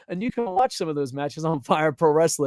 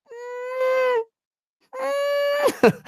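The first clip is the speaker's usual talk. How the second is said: very high, soft mock whimper; 5 dB quieter than usual. Watching an awkward wrestling move, high mock whimper.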